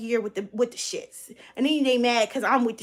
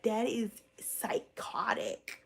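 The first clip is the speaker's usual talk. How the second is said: soft, slow whispered judgement; whispered verdict; 2 semitones lower, 9 dB quieter, much slower than usual. Real, not acted. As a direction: whisper it like a secret. Judging fans' behaviour.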